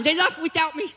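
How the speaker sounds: high pitiful cartoon sloth pleading to be loved; Sid the Sloth. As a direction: do the lonely, whiny cartoon sloth. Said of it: "I used to do Sid the Sloth all the time".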